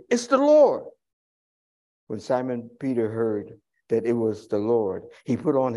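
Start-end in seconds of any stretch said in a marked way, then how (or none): 0.1-0.8 high, loud recognition; excited cry. Retold or imitated. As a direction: call it out, thrilled. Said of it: "they said, uh, to Peter".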